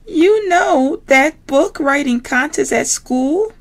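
very high squealy piggy voice; Miss Piggy voice. Acted. Miss Piggy to classmates, very high pig voice. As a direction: play the diva pig, high and bright.

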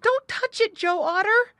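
a scared muskrat begging his friend to stop; panted warning. Acted. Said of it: "he panted".

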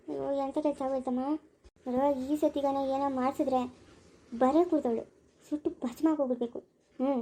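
softly, as if to oneself; scheming aside. Acted. Schemer laments and plots aside.

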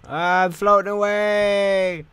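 high, slow silly yell; goofy shout. Pretend. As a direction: wail it out like a cartoon. Goofy shout while drifting.

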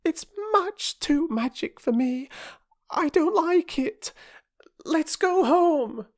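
high, pleading and slowing; scared little girl. A narrator's reading. Little girl wanting to leave, high and fearful. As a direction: beg to go home. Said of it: "said Phyllis".

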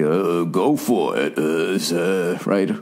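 low, slow slurred Stallone impression; lazy Stallone mumble, half-hearted; mumbling Stallone. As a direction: mumble it like a punch-drunk action hero. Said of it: "gave Stallone to be".

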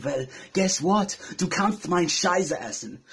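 slow put-on German accent. Rapper called a German answering back, German accent. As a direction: draw it out in a mock German accent. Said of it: "since you wanna call me a German".

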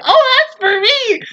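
high, drawn-out delighted claim; eager drinker. Acted. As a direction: pipe up high and slow, playfully. Acted quote of drinker grabbing alcohol.

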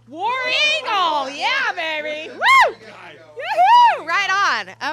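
loud whooping cheer, pitch leaping high; Auburn cheer. Real, not acted. Mother cheering her son's Auburn team, whooping loudly. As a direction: yell it like a fan in the stands.

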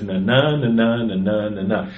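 na, na chanted da-DUM, steady meter; iambic beat demo. Shown as a demonstration. Showing co-hosts the meter under a poem, chanting. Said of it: "if you listen to the underlying meter".

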